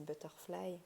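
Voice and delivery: playful attempt at an English accent; French speaker putting on a slight English accent. Shown as a demonstration. Vlogger showing off English to viewers, English accent. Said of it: "mon super accent anglais".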